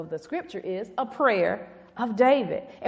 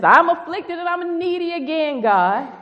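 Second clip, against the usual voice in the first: higher, louder cry of need; impassioned plea; 6 semitones higher, 7 dB louder than usual. Acted. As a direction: cry it out to God. Preacher voicing psalm plea.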